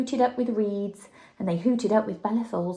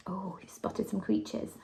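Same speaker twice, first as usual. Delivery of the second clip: hushed unvoiced whisper; 10 dB quieter than usual. Real, not acted. Reading with her child, whispering about the creatures in the picture. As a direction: breathe it as a secret. Aside to child over the book.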